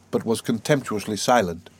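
ordinary delivery

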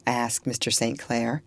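ordinary delivery, nothing marked

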